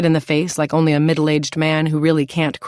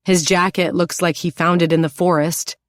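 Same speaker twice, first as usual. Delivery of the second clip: plain reading voice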